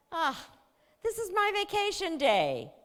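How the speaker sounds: put-on high, loud whining teenager; my vacation day, high and whiny; whiny teen protest. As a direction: complain like a put-upon sixteen-year-old. Teen daughter whines protest.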